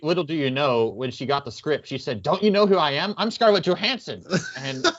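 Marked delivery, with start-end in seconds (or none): 2.2-4.2 higher, pretend diva outrage; hammed-up self-importance; mock indignation. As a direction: play at being an offended star. Joke of indignant young star.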